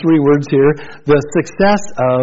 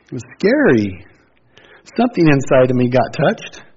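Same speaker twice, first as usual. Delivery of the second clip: ordinary talk, nothing marked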